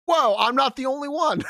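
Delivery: high, excited mock shout; amazed outburst. Reading out a commenter who found others like them, amazed. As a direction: blurt it out in astonished relief.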